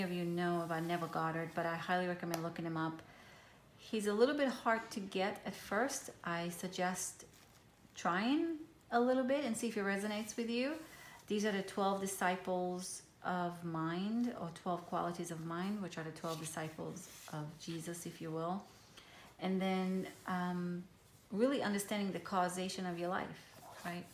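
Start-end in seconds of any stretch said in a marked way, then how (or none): none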